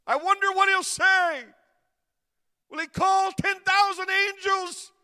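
high, full-force preaching cry; loud, soaring pulpit shout; preacher's shout. Real, not acted. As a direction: throw the question out at the top of your voice. Preacher cries out rhetorical question.

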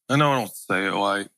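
mope like nothing goes right; mopey self-pity in a low voice; mopey whine. Retold or imitated. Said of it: "you ever felt like that".

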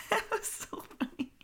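high laughing squeal. Real, not acted. Laughing about the movie line, breaking up. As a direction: crack up through the words.

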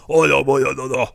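loud, garbled imitation of a Scottish speaker; unintelligible Scottish speech. Translating Gary for a baffled friend, garbled mock Scottish. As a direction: mimic an incomprehensible Scottish accent in near-nonsense. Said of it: "it'll sound like".

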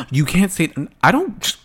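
high, loud, flustered sputter; hammed-up shocked stammering; mock scandalized viewer. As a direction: play an outraged follower who can't get words out. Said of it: "some of you can be like".

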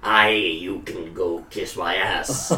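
low, slow, put-on creature voice; creature's character voice. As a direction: draw it out low for a stealthy creature.

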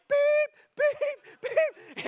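loud, high-pitched bleeps; imitated censor beeps. The guy starts F-bombing Jesus, loud high censor bleeps. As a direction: beep out each swear word at the top of the voice. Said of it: "starts F-bombing Jesus, just like".

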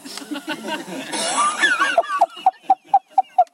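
long very high wail breaking up; hammed-up high sobbing; mock crying. Reenacts caller's crying.

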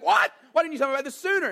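loud, high outburst of astonishment; blown-away little boy. Acted. Little boy to mom on hearing of the toilet, blown away. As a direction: play a kid's quick, stunned protest. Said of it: "he's blown away".

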